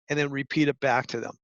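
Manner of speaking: usual speaking voice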